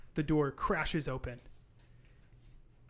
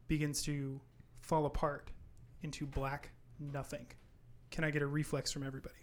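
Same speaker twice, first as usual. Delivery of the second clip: normal talking voice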